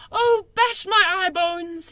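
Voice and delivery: high, loud, slow wail; distressed cry. A narrator's reading. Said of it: "he cried".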